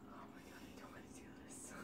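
dreading whisper; hushed, scared whisper. Real, not acted. Dreading eating A1 sauce.

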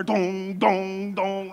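ringing mock thumps, evenly repeated; imitated tail thumps. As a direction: imitate a tail banging on a dryer. Imitates dog's tail banging dryer.

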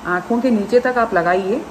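fingers brushing lips; talking while rubbing cheek; hand partly over mouth. Real, not acted. Seen 0: Spreading face pack, fingers partly covering mouth corner while speaking.

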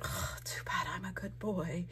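a soft unvoiced whisper as the good-boy dog. Crafter voicing the dog eyeing the cupcake, whispering. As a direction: breathe it like a dog tempted by cake. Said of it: "the dog is like".

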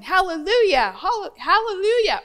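loud, high joyful shout of praise; hollering hallelujah. Retold or imitated. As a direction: call it out like he's overflowing with joy. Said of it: "he started hollering".

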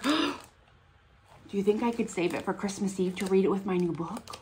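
usual speaking voice

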